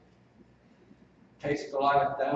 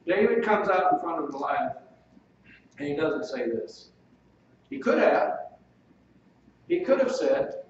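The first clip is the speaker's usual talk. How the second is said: ordinary delivery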